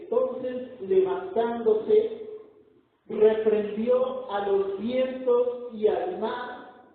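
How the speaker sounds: loud, ringing preacher's proclamation; full-force preaching. Acted. Preacher booming the sermon point to the congregation. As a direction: proclaim it to the whole church.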